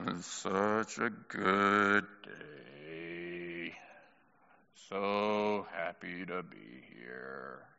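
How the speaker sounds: deep, very slow, dreary drone; Eeyore. Acted. Preacher mocking joyless rejoicing, gloomy Eeyore drone. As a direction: drag out every word, low, soft and joyless. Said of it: "Nobody rejoices like".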